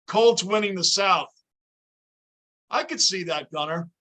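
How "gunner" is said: ordinary delivery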